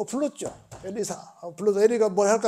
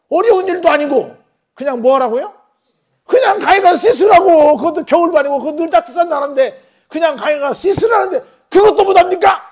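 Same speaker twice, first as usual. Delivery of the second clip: much higher and louder rebuke; exasperated scolding; 9 semitones higher, 9 dB louder than usual. Retold or imitated. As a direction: scold the master for refusing something so easy. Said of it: "하고는 막 뭐라 그러는 거예요".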